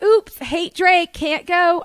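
high, slow, loud mock regret; breezy fake excuse. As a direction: stretch out a put-on excuse. Fake excuse to dodge him.

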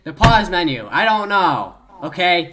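loud defensive. Real, not acted. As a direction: snap back loudly in defence.

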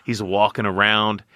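usual speaking voice

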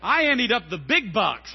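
ordinary talk, nothing marked

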